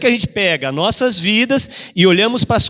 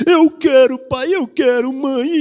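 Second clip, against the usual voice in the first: put-on high, whining child begging; mock child whine, higher; whiny child; 5 semitones higher, slower than usual. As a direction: plead like a spoiled kid wanting it.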